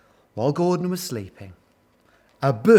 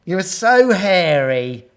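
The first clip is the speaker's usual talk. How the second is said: high, slow, gushing compliment; admiring; 5 semitones higher, 9 dB louder, slower than usual. A narrator's reading. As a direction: swoon over the hero. Gorillas swoon over hero Gordon.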